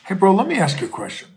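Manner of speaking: brisk, friendly phone opener; retold call. Calling his reporter friend in Mexico, casual and quick. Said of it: "and I said".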